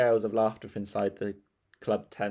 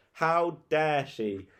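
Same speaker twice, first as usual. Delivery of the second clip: indignant; 6 semitones higher, much slower than usual. Acted. Margaret outraged.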